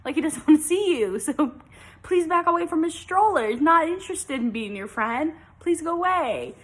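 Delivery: hammed-up apology that turns into a brush-off; mock sassy apology. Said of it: "now I have to say".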